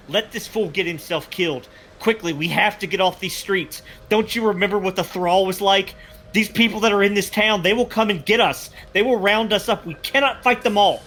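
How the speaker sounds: high, urgent, pressing voice; urgent warning. Acted. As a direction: hurry them off the streets. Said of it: "he's like".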